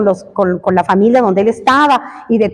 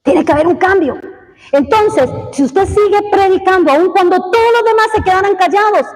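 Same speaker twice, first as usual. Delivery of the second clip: fervent preaching; 8 semitones higher than usual. Acted. Preacher telling the church how God sees them, fervent and raised. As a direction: lift the congregation with conviction.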